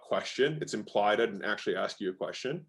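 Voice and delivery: ordinary talk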